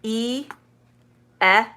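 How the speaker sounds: "E" as its letter name, then "eh" as in bed; long then short. Shown as a demonstration. Teacher contrasting the two E sounds for learners, long then short. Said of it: "long, short".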